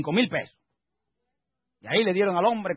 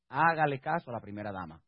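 low, soft delivery; quietly delivered punchline of advice; understated advice; 8 semitones lower, 5 dB quieter than usual. Real, not acted. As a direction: drop the voice low to slip in the advice. First take of advice, repeated louder.

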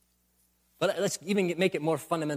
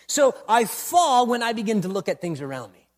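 louder, insistent sermon cadence; preaching at full force; 5 semitones higher, 5 dB louder than usual. Acted. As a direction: hammer each I fall home.

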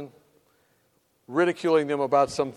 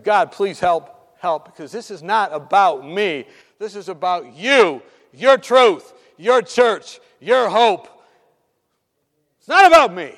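building from firm to loud, emphatic cries; pleading prayer; 9 dB louder, slower than usual. Retold or imitated. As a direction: plead with God, punching each phrase harder.

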